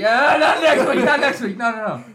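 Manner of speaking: high laughing mock dodge; mimicking Pat. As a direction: laugh through a whiny brush-off. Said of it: "He's like".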